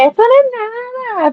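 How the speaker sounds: high, slow, drawn-out scoff; mock-dismiss the heat like it's nothing; hammed-up breezy disdain; exaggerated scoff. Retold boast, exaggerated replay.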